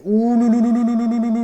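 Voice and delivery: long rolling "ou-lou-lou", held on one pitch; excited ululation. Real, not acted. Excited reaction to the slot reels. (ululation excitée)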